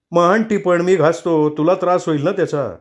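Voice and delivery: husband snapping sarcastically at his wife. Acted. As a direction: bite the words back at her. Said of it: "भाणकाऱ्यात बोलला".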